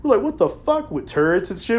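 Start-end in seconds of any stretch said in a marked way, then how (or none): 0.2-0.9 amazed. Retold or imitated. Amazed at tanks in warehouse.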